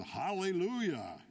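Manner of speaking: high, storyteller's yell; mock yell. A narrator's reading. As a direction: yell it high, pulling on the reins. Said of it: "and yelled".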